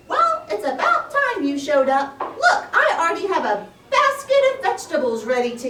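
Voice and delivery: high, loud, impatient hare voice; a perky, bossy rabbit. Acted. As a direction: scold him for showing up late. Hare scolds late tortoise.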